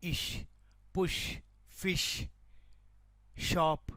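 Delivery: ఇష్, పుష్, ఫిష్, షాప్ each apart with a clear sh; "sh" sound demonstrated. Shown as a demonstration. Teacher gives example words for SH, clear sh. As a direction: stress the sh in each separate word. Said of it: "ఎస్హెచ్".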